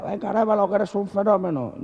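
high-pitched hype from an assistant; excited high-voiced cheerleading; cheering sword handler. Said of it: "el mozo de espada me decía".

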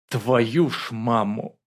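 slow, drawn-out oath of shock; gasped dismay. A narrator's reading. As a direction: let the oath out aghast. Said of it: "ахнул Богданов". (ахнуть, протяжно)

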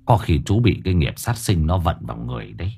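tongue-click and a weary, low verdict; resigned sigh. A narrator's reading. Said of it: "chặt lưỡi".

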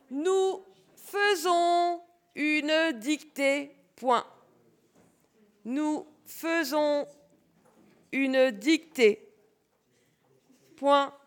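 slow, spaced-out dictation; dictating. Shown as a demonstration. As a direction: stretch each word for writing pupils. Teacher dictating slowly.

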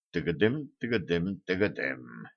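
low, soft mutter sinking at the end; musing imitation of clattering hooves, hushed; pensive muttering. A narrator's reading. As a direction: mutter the hoofbeats thoughtfully to yourself. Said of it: "задумчиво пробормотал гном".

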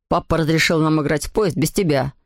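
put-on low-pitched boy's retort; brother to sister. A narrator's reading. Anselm shuts his sister out of the game, pitched low.

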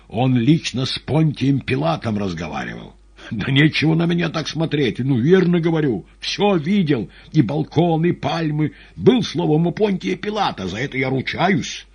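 high, loud, heated insistence; agitated madman poet, vouching. Acted. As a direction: insist loudly that you must be believed.

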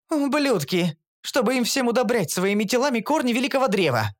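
high, furious female curse; cursing. A narrator's reading. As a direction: spit the curse at them. Elf curses guards. (эльфийка яростно проклинает)

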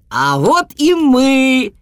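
high, loud, slow greeting; wolf cub calling out; exclaiming wolf. A narrator's reading. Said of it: "воскликнул Волчик".

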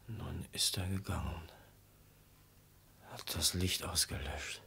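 sleepy hushed aside; drowsy whisper. Acted. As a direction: whisper alone in the dark. Alone in dark, falling asleep.

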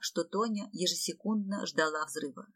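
ordinary delivery

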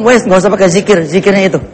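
usual speaking voice

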